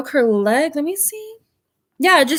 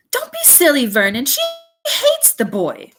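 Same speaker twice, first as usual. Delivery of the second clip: scornful aunt; 4 semitones higher than usual. A narrator's reading. Aunt Petunia scolding Vernon.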